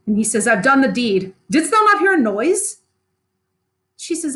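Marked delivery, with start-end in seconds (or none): 0.5-2.8 Macbeth, raised and higher, rattled after the killing; shaken Macbeth. Acted. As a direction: blurt it out like a man who just killed. Teacher voices Macbeth after murder.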